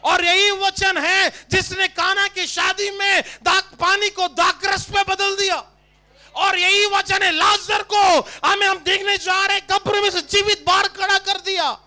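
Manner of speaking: loud, high, slow preaching; full-force preaching. Acted. Preacher proclaiming the Word to congregation, loud and slow. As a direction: preach it out to the hall.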